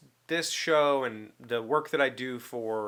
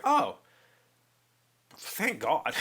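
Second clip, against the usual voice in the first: retold relief; 4 semitones higher, much slower than usual. Relief after feared bad news.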